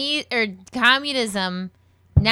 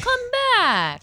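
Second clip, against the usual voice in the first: high, slow mock Chinese accent; squeaky mock Chinese accent; 4 dB louder than usual. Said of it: "That was my Chinese accent".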